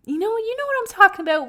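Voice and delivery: suddenly high and loud, fast; excited rush; animated. Real, not acted. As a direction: burst out to the viewers. Excited aside to viewers, high.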